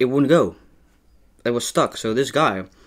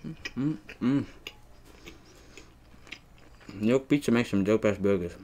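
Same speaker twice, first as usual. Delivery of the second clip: humming while chewing food; talking through a mouthful; mouth full, chewing; 5 dB quieter than usual. Real, not acted. Seen 0: Bites burger at -2, then chews with closed lips while humming.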